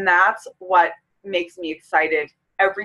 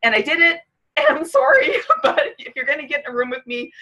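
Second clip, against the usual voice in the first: embarrassed laugh through tears; 6 semitones higher, faster than usual. Real, not acted. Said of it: "I said I wasn't gonna do it".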